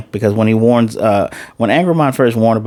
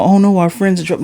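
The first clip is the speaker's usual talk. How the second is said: high mock-worried wail; mocking panic; 8 semitones higher than usual. Fan mocking the heroine's panic, high mock-worried voice. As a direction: parody her fretting, pitched up. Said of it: "This isn't, you know".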